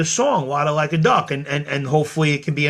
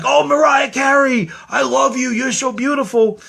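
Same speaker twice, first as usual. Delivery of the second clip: screaming fan; 8 semitones higher than usual. Pretend. Imagining gushing at [name], screaming starstruck fan. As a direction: gush and shriek like an over-the-top fan. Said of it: "if I was just like".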